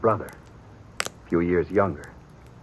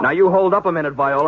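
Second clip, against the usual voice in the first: louder and higher, stopping her at the door; stern order; 9 semitones higher, 6 dB louder than usual. Acted. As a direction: command her to wait. Brother stops sister leaving.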